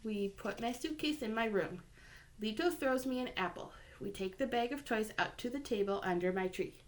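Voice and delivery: plain reading voice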